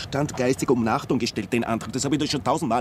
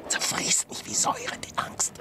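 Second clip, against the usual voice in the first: hushed, hopeless murmur; despairing whisper; 8 dB quieter than usual. Acted. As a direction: whisper it like it eats you.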